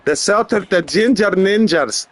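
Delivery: proud mock-Scottish tribesman; hammed-up Scottish brogue, ready to brawl; Scottish accent. Plays a proud Celtic tribesman.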